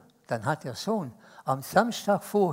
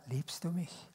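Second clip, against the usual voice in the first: tender question; 12 dB quieter, slower than usual. Acted. Jesus asking Peter softly.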